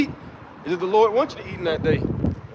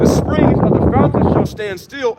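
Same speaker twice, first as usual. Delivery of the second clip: slower, higher and louder solemn read, rising on fountain; solemn scripture reading; 5 semitones higher, 7 dB louder, slower than usual. Acted. Reading the verse to listeners, slow and lifted. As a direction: proclaim the verse, lifting on fountain.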